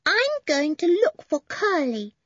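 lightly voiced toddler; small boy. A narrator's reading. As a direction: say it like a small child. Little boy Benny announces.

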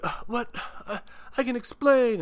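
high, put-on flustered voice; caught-out guy. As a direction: sound caught red-handed. Paul caught making out.

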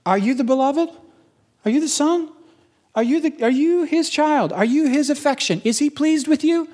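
high-pitched, needling interrogation; devil's taunting questions. Acted. The devil attacking the beloved's identity, high taunting challenge. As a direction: push up high and prod with each doubt. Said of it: "what's the attack of the devil".

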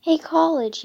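loud jeering call; yelling. A narrator's reading. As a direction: shout it across the line. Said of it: "yelled a fifth grader".

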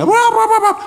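high, hammed-up bawling like a small child; mock crying wail. Little brother bawls after the fall, high mock wail.

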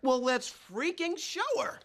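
high, loud rallying cry; rally your buddy to prove her wrong; riled-up defiance. Acted. Man rallies buddy to install dimmer, riled up.

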